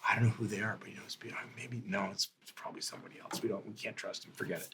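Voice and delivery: hushed, half-whispered back-and-forth; murmured. A narrator's reading. Lookouts confer about whether to trust the visitor, murmured. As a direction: murmur it very softly, as if overheard. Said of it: "murmured conversation".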